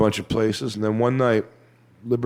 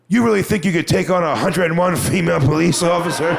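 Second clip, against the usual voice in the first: high, dead-serious incredulous demand; humorless challenge; 6 semitones higher than usual. Retold or imitated. Woman with arms folded waiting for an answer, humorless challenge. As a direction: demand an answer in a raised voice, no levity. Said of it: "No levity in her heart at all".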